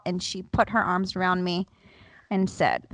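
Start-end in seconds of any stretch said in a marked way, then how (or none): none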